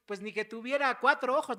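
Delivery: loud, indignant "ni que tuviera cuatro ojos"; loud mock exasperation; exasperated retort. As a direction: throw out "cuatro ojos" loudly, fed up. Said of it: "A veces nosotros decimos".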